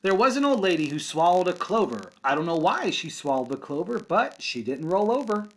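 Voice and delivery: lively singsong read-aloud for kindergarten; picture book storytime voice; reading to children. Acted. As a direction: read it with a bouncy rhythm for little kids. Teacher reads picture book aloud.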